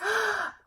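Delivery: quick high startled gasp; sharp gasp. A narrator's reading. Goldilocks realizing it's a dinosaur's house, sharp gasp. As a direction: suck in air in sudden alarm.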